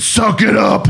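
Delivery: half-laughing command to toughen up; a laughing mock order to stop crying; mock stern hiss. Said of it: "this is exactly what I said".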